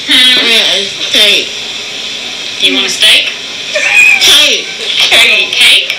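slurred, dazed words after surgery; groggy from anesthesia. Real, not acted. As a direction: mumble like you just woke up. Patient groggy after anesthesia video.

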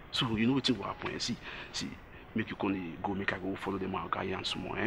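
hushed confiding. Acted. Agent quietly confides a plan to the customer. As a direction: draw her close and drop the voice.

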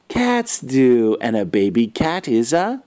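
plain reading voice

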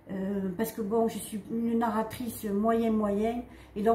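ordinary delivery

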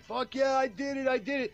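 high, excited imitation of a winner; imagined triumph. Interviewer voicing the comic's joy on screen, excited triumph. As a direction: voice someone else's elation, pitched well up.

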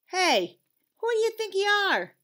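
high, loud scolding call; indignant shout. A narrator's reading. Conscience catching Leon littering, loud scolding call. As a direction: call out the culprit sharply.

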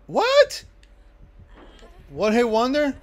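loud yelling, jumping very high; incredulous high-pitched shout; outraged disbelief. Real, not acted. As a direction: yell in disbelief at being hit. Outraged at being hit in game.

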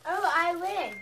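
loud, happy win; excited. Real, not acted. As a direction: cheer the win. Kid thinks they won.

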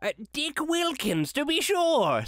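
put-on an old man recognising a friend; Scrooge. A narrator's reading. Scrooge voiced in the story.

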